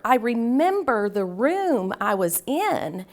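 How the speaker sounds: ordinary talk, nothing marked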